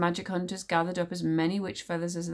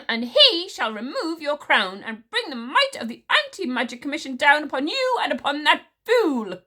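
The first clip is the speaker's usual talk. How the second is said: louder, indignant ranting threat; 8 semitones higher, 7 dB louder than usual. A narrator's reading. Witch smeller threatening the queen, indignant. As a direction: bluster the threat. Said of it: "trembled with indignation".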